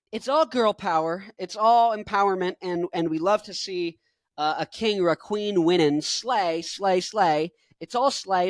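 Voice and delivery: ordinary talk, nothing marked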